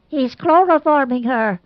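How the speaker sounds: high alarmed shriek; a startled shriek at the sight. A narrator's reading. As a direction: cry it out in alarm. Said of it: "shrieked Aunt Mauser".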